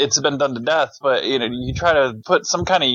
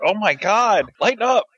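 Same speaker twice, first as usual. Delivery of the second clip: a fed-up, pitched-up groan of exasperation; exasperated plea; 8 semitones higher than usual. Retold or imitated. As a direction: beg the movie to lighten up, fed up. Mocking the movie's relentless music.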